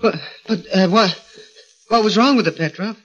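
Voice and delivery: high, startled and stammering; flustered little man caught off guard; nervous pleading. Acted. Gorgo stammers back at Petrov, high and nervous.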